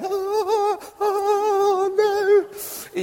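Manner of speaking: high-pitched drawn-out wails of panic; mock battle screaming. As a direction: wail like a defender under attack. Acting out the battle cries.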